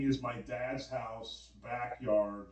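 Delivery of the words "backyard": usual speaking voice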